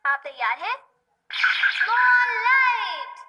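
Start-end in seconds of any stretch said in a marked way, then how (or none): 1.3-3.2 gadget announcement. Acted. Robot cat presents a gadget.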